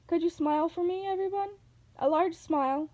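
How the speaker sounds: high, sugary request; sweet coaxing old lady. A narrator's reading. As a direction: coax the kids into smiling. Old lady posing them for photo.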